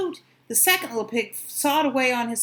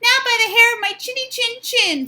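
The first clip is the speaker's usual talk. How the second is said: high loud little pig; high defiant pig voice; 13 semitones higher, 7 dB louder than usual. Acted. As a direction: refuse in a squeaky pig voice. Pig defies wolf.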